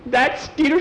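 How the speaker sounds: high, loud exasperation; exasperated outburst. Acted. Nancy blames Skeeter for the exploded cake, exasperated outburst.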